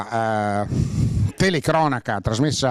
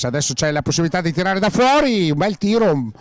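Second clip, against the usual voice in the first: quick, higher-pitched burst of live commentary; excited shot call; 5 semitones higher than usual. Real, not acted. Commentator calls a long-range shot, excited.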